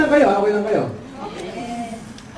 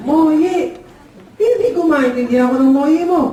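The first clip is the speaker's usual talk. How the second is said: higher, puzzled questioning; baffled father; 6 semitones higher than usual. Acted. Store-owning father unable to understand the customer, baffled. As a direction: demand to know what that means. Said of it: "Sabi ng tatay".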